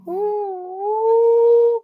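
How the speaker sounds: long, high drawn-out "woo"; high sustained cooing howl; imitated eerie noise. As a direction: imitate a distant hooting call, held long. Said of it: "I hear this noise. It's like a".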